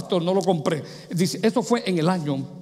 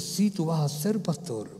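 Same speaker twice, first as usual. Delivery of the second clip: soft, slow repeated assurance; lowered voice of God; 3 semitones lower, 6 dB quieter than usual. Acted. God repeating his answer to the man, softly. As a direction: say it hushed and unhurried. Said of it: "me lo dijo tres veces".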